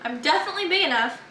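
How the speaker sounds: ordinary delivery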